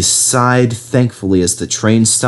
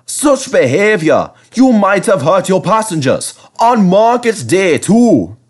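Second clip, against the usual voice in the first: put-on higher, louder stern old engine; scolding; 10 semitones higher, 4 dB louder than usual. A narrator's reading. Skarloey telling off the rude coaches, scolding. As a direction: scold them like naughty children.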